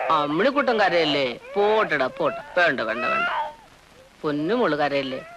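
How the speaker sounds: put-on low, gentle baby hushing; low cooing reassurance; tender soothing. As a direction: comfort a crying baby, pitch dropped well down.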